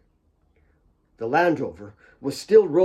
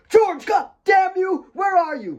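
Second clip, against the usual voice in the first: screaming; 4 dB louder than usual. A narrator's reading. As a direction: scream for George in anger. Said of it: "Mary screamed".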